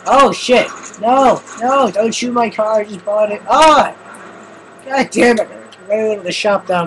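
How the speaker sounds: loud high distressed shouting; distressed yell. Real, not acted. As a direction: yell as your new car gets shot. His new car being shot.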